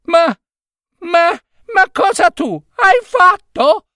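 very high-pitched, spluttering scolding; outraged yelling. A narrator's reading. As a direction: cry out at a foolish husband in disbelief. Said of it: "gridò Giorgina". (grida acute e indignate)

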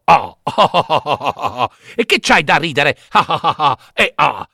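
puppet's teasing oh oh laugh; mocking laugh. Pinocchio laughs at the cricket's warning.

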